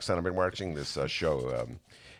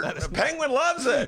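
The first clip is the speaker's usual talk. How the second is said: high, slow squawky penguin voice; squawky bouncy penguin; 13 semitones higher, 3 dB louder, slower than usual. Pretend. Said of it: "your penguin impression".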